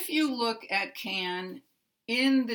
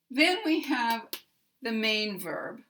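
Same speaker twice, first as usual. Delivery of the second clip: normal talking voice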